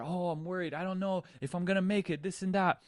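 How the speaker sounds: high, fast worried babble; mock panic. Drowning surfer, rattling off worries high and fast. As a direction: rush the words like fear is rising. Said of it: "You're panicking".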